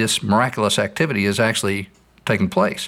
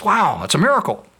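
amazed man; 9 semitones higher than usual. Retold or imitated. Man sees God walk on water.

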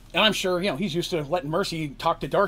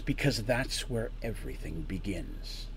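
cool reply; 4 semitones lower, 6 dB quieter, slower than usual. Acted. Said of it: "And he replies".

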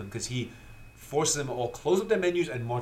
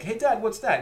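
put-on curious kid; 9 semitones higher, 4 dB louder than usual. Kid asking at dinner table.

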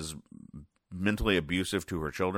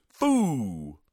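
higher, drawn-out "phoo" falling away; spit take; 6 semitones higher than usual. Acted. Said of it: "she does a spit take".

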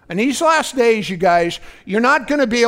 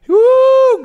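joyful woo; 8 dB louder than usual. Real, not acted. Preacher shouting praise.

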